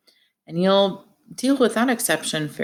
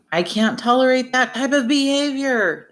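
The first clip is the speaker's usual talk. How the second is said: high-pitched, indignant acted yell; mock yell; 8 semitones higher than usual. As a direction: act out an outraged yell without full volume. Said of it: "Smith yelled".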